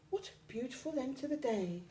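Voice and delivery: lightly voiced fly; little fly. A narrator's reading. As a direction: say it lightly and quickly. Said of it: "said the fly".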